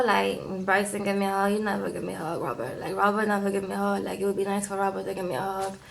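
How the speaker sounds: low, soft, hurried complaint; mock plaintive mumbling, quick and low; imitated quiet grumble. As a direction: run the complaint together under your breath. Voices Annie's grumble about hugs.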